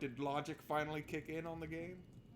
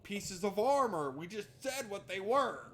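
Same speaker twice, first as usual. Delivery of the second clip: exasperated shout; 4 semitones higher, 6 dB louder than usual. Real, not acted. Exasperated reply to NPC question.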